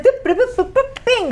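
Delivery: high playful boops; squeaky cartoon boops for the ponytail; boop sounds. Imitating ponytail being tied.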